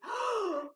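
big sharp intake of breath; dramatic storybook gasp; great gasp. A narrator's reading. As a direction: gasp in shock.

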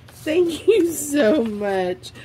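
giggling gratitude for a gift; laughing thanks. Real, not acted. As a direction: laugh through your thanks. Thanking friend, overcome with delight.

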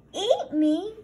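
high, loud shocked shriek. A narrator's reading. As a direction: shriek it in disbelief. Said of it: "Arnie shrieked".

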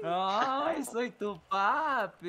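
high, drawn-out mock swoon; mock-affectionate teasing. Player teasing teammate about being his daddy, mock-affectionate. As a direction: coo it with stretched-out vowels, teasing.

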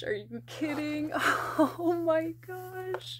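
teary squealing joy at the plush; overjoyed squeal. Real, not acted. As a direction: gasp and squeal like a dream came true. Finally unboxing the dream plush.

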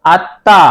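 আত্মা as at-tã with double t; two-part at-ta for আত্মা; correct pronunciation. Shown as a demonstration. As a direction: linger on the t of আত্মা, no m heard. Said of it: "এটা উচ্চারণ হবে".

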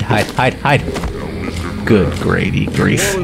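ordinary delivery

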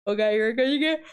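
ordinary talk, nothing marked

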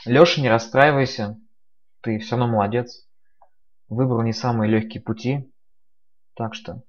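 usual speaking voice

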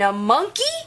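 plain reading voice

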